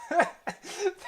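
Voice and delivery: squeaky burst of laughter; high squealing laugh. Real, not acted. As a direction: let out a high helpless laugh. Cracks up at old quote.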